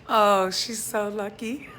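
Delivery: loud mock gushing, jumping up high at the end; joking exclamation. Vlogger joking about who wakes up to them, mock exclaiming loudly. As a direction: belt it out as a joke, pitch leaping up. Joking aside about partner, then "messing around.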